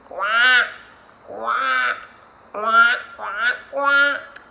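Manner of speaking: loud, short duck quacking; duck quacks. Shown as a demonstration. Teacher makes animal sounds for kiddos, loud quacking. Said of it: "I'm going to make animal sounds".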